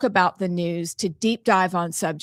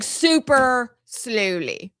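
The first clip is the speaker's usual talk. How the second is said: drawn-out, exaggeratedly slow words; super slow; 3 semitones higher, much slower than usual. Shown as a demonstration. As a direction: stretch each word way out. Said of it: "say the number very slowly".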